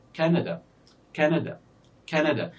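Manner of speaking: Canada with a strong first syllable, two lighter ones after; stress on first syllable. Shown as a demonstration. Teacher showing why the first is longer, first-syllable stress in Canada. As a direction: lean on the opening of Canada, let the rest fall away. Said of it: "the first syllable is stressed here".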